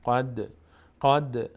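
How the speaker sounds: قد with a bouncing release on the d; qalqala on قد. Shown as a demonstration. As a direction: let the final d of قد echo briefly. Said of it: "در مخرجش میلرزه".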